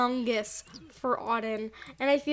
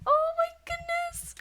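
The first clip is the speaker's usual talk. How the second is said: starstruck fan. Retold or imitated. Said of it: "everyone here is probably like".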